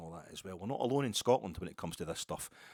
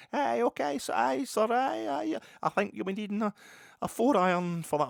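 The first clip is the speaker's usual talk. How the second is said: hammed-up high cinema Scots accent, loud; mock Hollywood Scottish caddie voice; 9 dB louder than usual. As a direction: camp it up as a movie Scotsman for American golfers. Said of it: "proper Scottish accent as far as American, the stereotypical cinema Scot".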